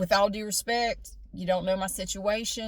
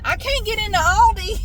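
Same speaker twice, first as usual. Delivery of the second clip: higher, louder complaint at the traffic; exasperated driving outburst; 11 semitones higher, 9 dB louder than usual. Real, not acted. Driver blocked from getting into Aldi, exasperated. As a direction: raise the voice in real frustration.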